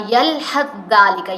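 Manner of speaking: یلحظ ذالک slow, the ث swallowed into a doubled ذ; tha merged into dhal. Shown as a demonstration. Teacher showing students the merged یلحظ ذالک. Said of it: "زال پڑھے گا".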